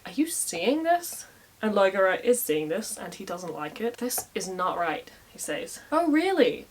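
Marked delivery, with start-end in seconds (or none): 0.0-1.3 high, slow, disbelieving; pitched-up mock disbelief; incredulous aside. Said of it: "Conchobar turns to Loigerer and is like". 5.9-6.7 high, falling on really; sarcastic oh really; sarcastic challenge. Acted. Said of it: "says Kit".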